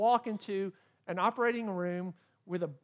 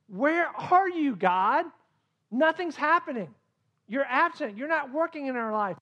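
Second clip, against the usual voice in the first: anguished cry to God; 8 semitones higher, 7 dB louder than usual. Retold or imitated. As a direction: cry out to an absent God in despair. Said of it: "we go".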